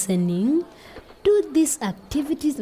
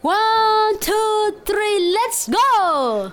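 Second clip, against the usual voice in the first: loud, high, drawn-out count; rallying count-in; 10 semitones higher, 4 dB louder, much slower than usual. Real, not acted. Teacher cueing children to recite, rallying call. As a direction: call the whole group in.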